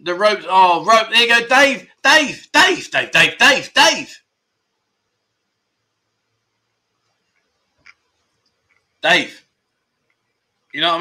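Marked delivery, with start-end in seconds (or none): 1.5-9.5 high, loud repeated calling of a name. Real, not acted. Host calling out to viewer Dave, loud and high. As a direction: call Dave's name over and over, pitched up high.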